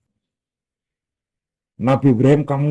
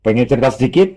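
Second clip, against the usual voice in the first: louder, coaxing questions; teasing offer; 3 dB louder than usual. Acted. Abah offers his children a story, playfully louder. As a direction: tempt the listener with a story. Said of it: "Ngomongnya kayak gitu".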